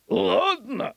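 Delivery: high, good-humoured assent; indulgent governor. Acted. Governor agrees to let dog choose, indulgent assent. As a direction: grant it with an amused lilt. Governor grants trainer's request.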